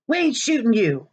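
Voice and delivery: high whiny protest; whining kid. Acted. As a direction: brat whining back at Maniac. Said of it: "Russell whined".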